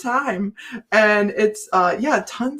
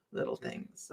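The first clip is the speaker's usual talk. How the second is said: "little things" whispered with no voice; 20 dB quieter than usual. Shown as a demonstration. Stressing how small the crystals are, whispered. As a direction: breathe it out as a secret.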